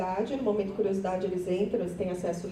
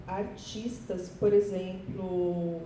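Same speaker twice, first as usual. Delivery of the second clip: ordinary talk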